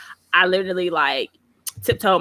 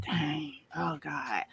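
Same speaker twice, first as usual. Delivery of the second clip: low, very soft dismay; retold crestfallen aside; deflated muttering; 5 semitones lower, 12 dB quieter, much slower than usual. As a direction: let it sink away almost to a whisper. Retold dismay at customer's rejection.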